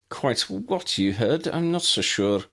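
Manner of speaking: high, prim Scottish headmistress voice; brisk, proper elderly professor; McGonagall. A narrator's reading. McGonagall continues wryly.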